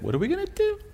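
hammed-up rising squeal of worry; mock contained panic. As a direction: voice climbing into a tiny high squeak. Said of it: "doesn't wanna panic. She's like".

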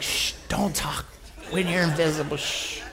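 mock-urgent hushed warning; urgent hushing. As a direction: hush the kids, keeping it quiet. Hushing children while hiding.

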